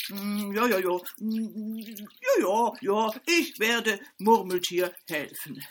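loud humming beaver agreeing. A narrator's reading. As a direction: hum and nod it out like the beaver.

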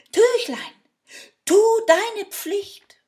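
very high, loud, slow incantation; spell called out. Acted. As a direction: proclaim the magic words grandly. Man calls the magic spell.

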